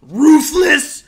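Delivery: loud, slow, high promo shout; wrestling promo shout. Acted. Hyped wrestling catchphrase.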